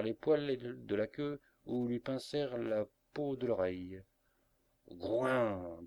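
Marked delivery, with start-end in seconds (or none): 4.9-5.8 drawn-out animal grunt as a word; bear grunt. A narrator's reading. Tom the bear as curious theatre-goers pinch him, grunting. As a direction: grunt it like a bear, long and swelling.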